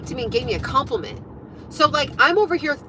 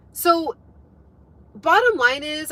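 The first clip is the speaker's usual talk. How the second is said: normal talking voice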